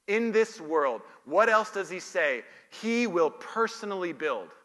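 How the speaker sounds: ordinary delivery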